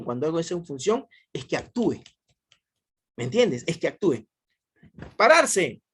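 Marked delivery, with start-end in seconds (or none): 5.2-5.8 high, loud order to stand up; teacher demonstrating a command to stand. Shown as a demonstration. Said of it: "Ah, es conativa".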